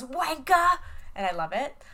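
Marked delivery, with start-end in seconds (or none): 0.0-0.8 high shouted Cockney insult; Cockney shout of wanker; mock Cockney yell. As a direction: yell it like a Cockney girl at a car. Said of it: "is Isabel yells".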